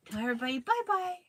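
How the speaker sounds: playful coaxing sing-song. Real, not acted.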